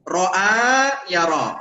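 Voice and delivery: ro'a ya ro stretched out slowly; slow teaching demo. Shown as a demonstration. Teacher slowly models ro'a ya ro again.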